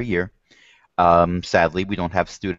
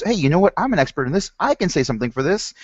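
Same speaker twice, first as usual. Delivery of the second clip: higher, eager aha voice; a sudden eager eureka; excited realization; 8 semitones higher, faster than usual. Retold or imitated. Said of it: "suddenly they realize".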